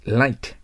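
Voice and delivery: "Light" with the tongue tip on the upper teeth; clear English L. Shown as a demonstration. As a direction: start "Light" on a firm L. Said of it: "Lの発音ですから".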